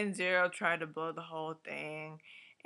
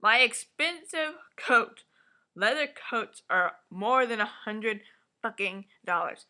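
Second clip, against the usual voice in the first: outraged rant; 3 semitones higher, 8 dB louder than usual. Retold or imitated. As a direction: play the furious coat owner. Outraged rant about the coat.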